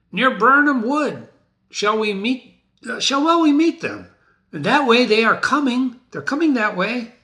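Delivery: high, eager soldier's voice; Angus asking. Acted. Angus asking the lords where to meet, high and eager.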